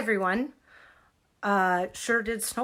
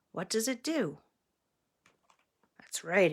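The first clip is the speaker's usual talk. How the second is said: plain reading voice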